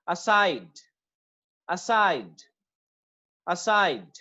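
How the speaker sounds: Aside as short "uh", long "SIDE", pitched low; second-syllable stress. Shown as a demonstration. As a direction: hold the stressed "side" in Aside, letting it fall. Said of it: "second syllable पे होगी".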